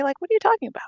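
bewildered. Retold or imitated. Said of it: "I was like".

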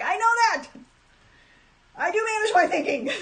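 high, loud, fed-up comeback; a loud, high "I know that!" with a laugh at the end; exasperated retort. Retold or imitated. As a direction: snap back that you already know. Exasperated retort to her doctor.

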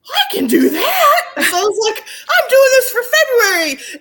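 high, loud gushing eagerness; bursting with can-do enthusiasm; laughing, giddy promise to herself; excited. Retold or imitated. Eager quote of her own challenge.

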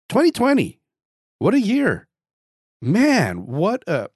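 high, loud incredulous exclamation; exclaiming. Real, not acted. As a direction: throw up your hands at the year. Exclaiming about 2020 again.

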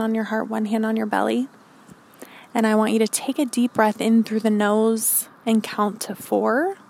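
calm grounding exercise. Acted. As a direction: guide them gently into stillness. Leads grounding meditation.

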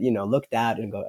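ordinary delivery

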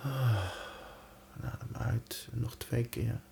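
very soft, slow breathing cues; soft spa whisper. Acted. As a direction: hush it down to guide the breath. Counts down the breaths.

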